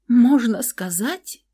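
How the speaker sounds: high, slow, rising disbelief; taken aback. Acted. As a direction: echo it stunned. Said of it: "опешила я".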